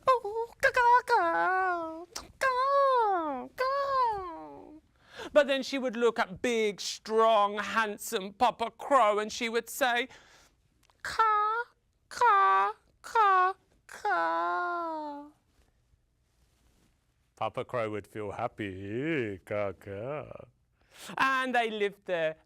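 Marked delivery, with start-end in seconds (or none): 0.1-4.8 very high, coy caws; shy, embarrassed crow. Acted. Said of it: "she would act a bit shy and a little bit embarrassed". 11.0-15.2 high, ringing caws, last one drawn out; loving crow reply. Acted. Mother crow answers with love. 17.4-20.2 very low, contented crow voice; happy papa crow. Acted.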